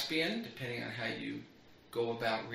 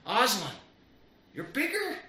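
put-on much higher child's voice, full of wonder; wide-eyed little girl; 10 semitones higher, 4 dB louder than usual. A narrator's reading. Lucy gazing up at Aslan, awed child's voice. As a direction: play young Lucy amazed at the lion.

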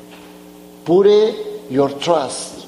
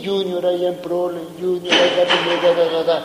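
fast, tumbling imitation of a fussing person; rushed mock complaint; much faster than usual. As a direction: rattle it off like a worried chatterbox. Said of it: "the goofy came to me".

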